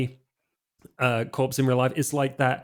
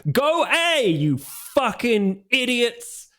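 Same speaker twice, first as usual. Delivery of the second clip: furious Australian gamer screaming at his screen; strong Australian accent; 10 semitones higher, slower than usual. Acted. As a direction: rage at teammates in thick Australian. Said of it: "in a very strong Australian accent".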